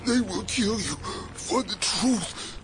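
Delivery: slow, high, labored words; strained voice of someone falling apart; dying warning. Acted. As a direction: gasp out a last warning, phrase by phrase. Dissolving figure gives last warning.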